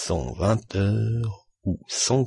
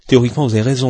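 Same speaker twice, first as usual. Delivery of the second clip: loud, higher-pitched concession; emphatic argument; 4 semitones higher, 8 dB louder, much faster than usual. A narrator's reading. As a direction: come in forcefully to argue back. Stuart argues back at Fogg. (argumentation appuyée)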